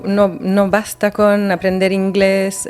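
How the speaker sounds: ordinary talk, nothing marked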